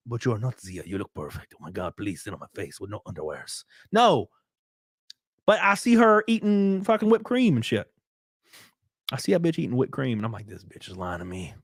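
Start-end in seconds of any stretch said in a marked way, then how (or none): 0.1-3.6 low, soft mock fan voice; lower, softer voice, teasing; mock hushed reply. 10.5-11.5 mock muttered thought. Inner thought voiced low.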